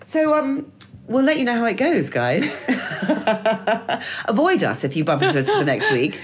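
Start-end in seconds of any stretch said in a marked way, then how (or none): 2.5-4.2 high laughing burst; burst of laughter. Real, not acted. Joking with listeners, real laughter.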